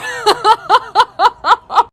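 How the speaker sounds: high, pulsing cackle; helpless laughter. Real, not acted. Storyteller ending an embarrassing confession, helpless laughter. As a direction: crack up in a long high laugh.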